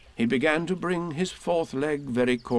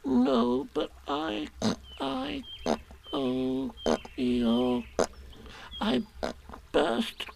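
small, high, halting confession; Piglet, faltering; 12 semitones higher, 4 dB quieter, much slower than usual. A narrator's reading. Piglet confessing to Eeyore about the balloon, high and faltering. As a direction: break it up with hesitant pauses, softly.

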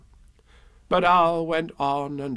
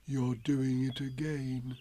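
soft, slow and downcast; sad; 10 dB quieter, slower than usual. A narrator's reading. Pooh to Owl, sad and hushed. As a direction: murmur it sorrowfully. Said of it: "said Pooh sadly".

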